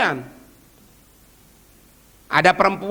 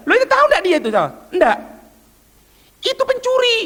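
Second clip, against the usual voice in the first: high, pointed reveal; spring the news, pitched up; incredulous friend; 13 semitones higher, faster than usual. Retold or imitated. Friend tells the giver the beggar was a thief, high and pointed.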